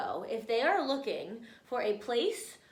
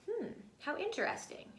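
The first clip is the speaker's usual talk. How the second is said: musing; 8 semitones higher, 8 dB quieter, faster than usual. Real, not acted. Teacher musing over text.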